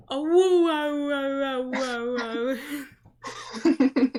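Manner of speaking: high, loud drawn-out dog howl; playful loud husky awoo. Acted. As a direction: howl like a little husky, wavering down. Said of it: "he starts doing his little husky howl".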